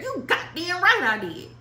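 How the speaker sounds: ordinary talk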